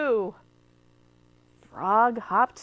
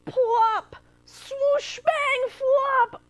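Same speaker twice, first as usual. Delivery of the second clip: much higher and louder, tumbling out; high, loud splashy sound effects; excited sound words; 12 semitones higher, 5 dB louder, much slower than usual. A narrator's reading. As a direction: call each crash like a cartoon. Animals tumble out.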